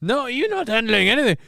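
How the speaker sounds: high, mock angry repeat; a hammed-up echo of the angry clip; imitating an angry woman. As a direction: parrot her angry line way up high. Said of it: "Japanese people can get angry".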